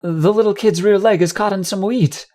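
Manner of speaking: worried onlooker calling out the danger; alarmed bystander. Acted. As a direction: raise the alarm, high and insistent. Bystander alarmed at trapped child.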